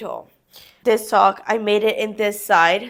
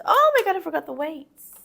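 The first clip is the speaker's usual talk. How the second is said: çok daha tiz, ani bir şaşkınlık; surprised; 7 semitones higher, faster than usual. Real, not acted. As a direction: birden hatırlamış gibi irkil. Suddenly remembers the weights.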